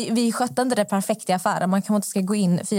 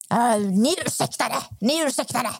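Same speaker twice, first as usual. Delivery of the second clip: imitated hot-tempered retort; 3 semitones higher than usual. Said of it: "hon har problem med sin temperament".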